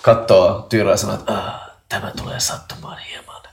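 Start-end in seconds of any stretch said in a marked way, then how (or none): 1.3-3.4 whispered, softer in-character line; hushed warning. Acted. Healer Jevan warning wounded Tyyrä before healing, hushed whisper. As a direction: murmur a warning to the patient.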